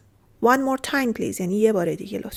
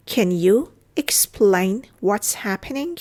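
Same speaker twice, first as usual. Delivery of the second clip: slow, pausing between chunks; careful teaching pace; slow model repeat; much slower than usual. Shown as a demonstration. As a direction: break the question up for learners. Teacher repeats phrase slowly.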